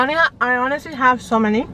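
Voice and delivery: talking through fingers; hand over mouth. Real, not acted. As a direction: mouth covered by palm. Seen 0: Hand covers mouth from 0.3s, still at 2.8s; just after eating.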